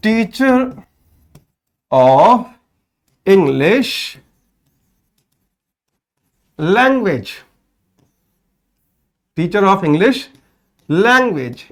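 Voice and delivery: teacher, of, English, language spaced out with long pauses; slow classroom dictation; slow word by word. Shown as a demonstration. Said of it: "तो सही बात क्या होती है?".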